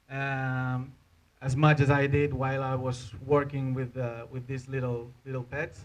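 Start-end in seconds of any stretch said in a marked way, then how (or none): none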